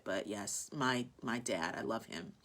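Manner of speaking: low, soft and close to tears; fighting back tears over her late dad; choked up. Real, not acted. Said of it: "I'm getting a little emotional".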